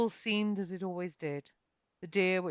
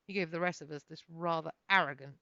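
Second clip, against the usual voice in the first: ordinary delivery, nothing marked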